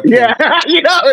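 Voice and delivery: real laughter breaking into a teasing agreement; laughing agreement. Real, not acted. Friend teasing her about the lady in the Uber, laughing. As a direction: laugh through the words, pitched high.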